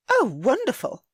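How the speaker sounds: high, loud sarcastic retort; snide. A narrator's reading. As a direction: toss it back with a sneer. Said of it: "A snide answer".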